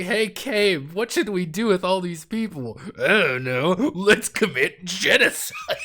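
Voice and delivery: high, loud cartoonish cheer; hammed-up dialogue breaking toward laughter; mock party chat. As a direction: pitch it up as breezy villainous banter.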